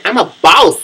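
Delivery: mock boast, high and loud; self-declared boss. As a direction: proclaim it loudly, imitating a bragging woman. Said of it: "and she's a fucking".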